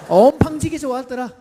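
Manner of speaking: loud, high Jeolla exclamation; emphatic Jeolla dialect demo. Shown as a demonstration. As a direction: hit 엄팡지게 hard in Jeolla style. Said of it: "전라도 버전 네 가지나 됩니다".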